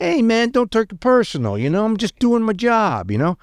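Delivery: high-pitched mock New Jersey mobster accent; bad Sopranos-henchman accent. Henchman excusing himself to the driver he threatened, horrible mobster accent. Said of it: "like the guys working for Tony Soprano".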